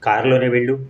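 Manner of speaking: plain reading voice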